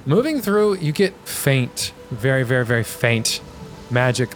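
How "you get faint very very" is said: ordinary talk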